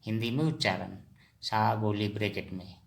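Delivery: usual speaking voice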